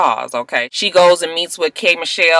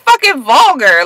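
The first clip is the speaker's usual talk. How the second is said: laughing disgust; 6 dB louder than usual. Real, not acted. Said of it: "That is so fucking vulgar".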